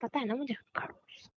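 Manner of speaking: whispered insult, barely there; muttered jab at her fiancé on the phone; under her breath. Acted. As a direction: breathe the insult so nobody nearby hears.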